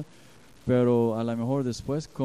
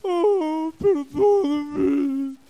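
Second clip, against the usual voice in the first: much higher, louder, slow overdone wail; mock whiny apology; 9 dB louder, much slower than usual. Pastor mocks a spouse's exaggerated apology, whiny and loud. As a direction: ham up a fake sorry. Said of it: "No venías".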